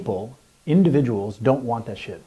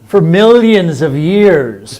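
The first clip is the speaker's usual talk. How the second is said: epic narrator; 5 semitones higher, 13 dB louder, much slower than usual. Pretend. Starts mock history narration.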